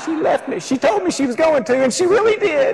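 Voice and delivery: high, hammed-up crying kid whine; mock child wail. Lost boy telling the couple his mama left, mock high wail. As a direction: wail it like an abandoned toddler.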